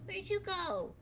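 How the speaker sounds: put-on a lost kid looking around; small confused child calling out; Rad the kid. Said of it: "he's looking around like".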